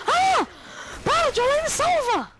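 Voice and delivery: high, loud cries for help; panicked screaming. Real, not acted. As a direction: scream to be saved. Grabbed by zombie.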